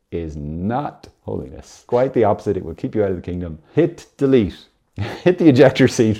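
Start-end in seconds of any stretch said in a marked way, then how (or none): 3.7-6.2 brisk, punchy spy command, louder and higher; hammed-up secret agent order; James Bond impression. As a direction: bark it like a suave spy hitting the button. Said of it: "This is my James Bond impersonation".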